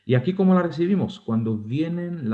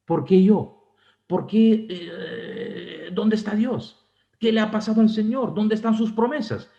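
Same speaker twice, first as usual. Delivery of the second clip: complaining why me; 7 semitones higher than usual. Retold or imitated. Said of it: "tú te preguntas".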